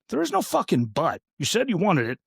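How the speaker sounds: exasperated, high-pitched protest; exasperated rebuttal. Real, not acted. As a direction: argue back at an objector, pitch jumping up. Arguing back at imagined objectors.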